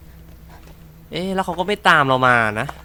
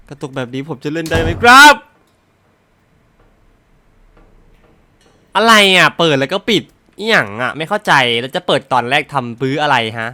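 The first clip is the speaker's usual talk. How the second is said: louder, exasperated griping at the lag; frustrated loud complaint; 9 dB louder than usual. Real, not acted. Streamer fed up with lag and the door, loud exasperated rant. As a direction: vent at the broken door like a fed-up gamer. Laggy game, door opens and shuts.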